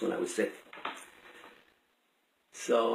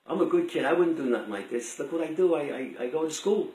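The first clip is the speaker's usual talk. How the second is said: ordinary talk, nothing marked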